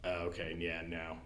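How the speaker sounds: normal talking voice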